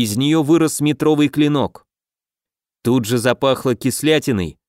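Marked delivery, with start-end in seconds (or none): none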